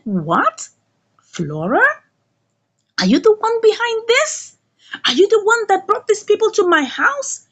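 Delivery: high, incredulous accusing questions; acted accusation. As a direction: play a suspect confronting his accuser. Suspect confronts Flora.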